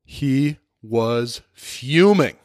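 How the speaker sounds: normal talking voice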